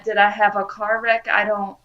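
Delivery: ordinary talk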